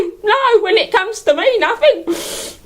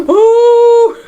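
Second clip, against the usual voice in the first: swooning oh; 2 semitones higher, 6 dB louder than usual. Acted. Swooning over Roger.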